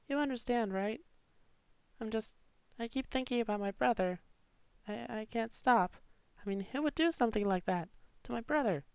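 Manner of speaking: put-on high, soft grieving girl; sad girl. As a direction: hold back tears, softly. Voicing Clover, grieving.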